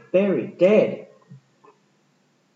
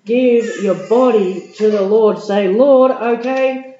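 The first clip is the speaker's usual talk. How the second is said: high, loud, excited preaching; preaching at full force; 6 semitones higher, 8 dB louder, slower than usual. Acted. Preacher rousing the congregation with a big, high, loud voice. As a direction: lift the crowd, rising high and loud. Appeal builds over music.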